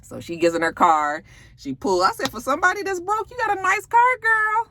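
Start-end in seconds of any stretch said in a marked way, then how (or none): 2.3-4.7 quick, high-pitched ribbing; teasing incredulity. Acted. Recapper teases broke character about her car, high incredulous. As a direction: tease her with mock amazement.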